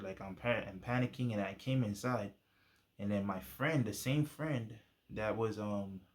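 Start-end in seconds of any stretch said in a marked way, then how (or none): none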